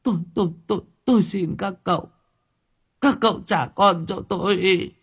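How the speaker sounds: high, stammering plea; pleading mother. Acted. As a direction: beg them for her child. Mother begs the thugs. (người mẹ van xin khẩn khoản)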